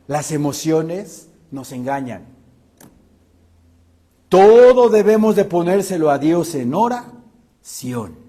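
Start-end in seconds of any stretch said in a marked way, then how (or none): 4.3-8.2 louder fervent exhortation; preaching emphasis. Real, not acted. Preacher urges congregation to bring everything to God, loudly. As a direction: urge them to pray, loudly.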